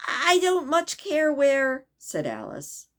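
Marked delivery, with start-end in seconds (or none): 0.1-1.9 put-on slow, a bit louder, girlishly unconcerned; a girl shrugging off the question; lightly voiced careless girl; Alice's voice.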